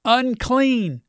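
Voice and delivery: high, drawn-out cry with a falling end; mildly acted call, pitched well up. Leper warning the people to stay away, calling out. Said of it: "calling out".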